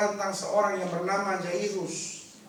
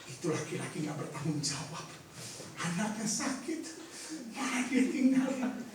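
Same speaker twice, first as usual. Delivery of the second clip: gossiping bystanders; 7 dB quieter than usual. Retold or imitated. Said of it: "Pasti banyak orang mulai ngomong".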